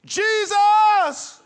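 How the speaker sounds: loud high cry of Jesus; desperate shout. Retold or imitated. Crying out while the car skids. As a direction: yell the name in panic. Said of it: "the only thing I could say was".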